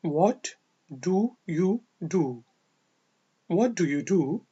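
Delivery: slow drill. Shown as a demonstration. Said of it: "drill split with pause".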